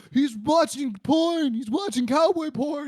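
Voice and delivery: high, drawn-out tattletale cry; shrill-pitched snitch, stretching each accusation out; tattling kid cousin. Retold or imitated. Cousin running downstairs to snitch to aunt, tattling.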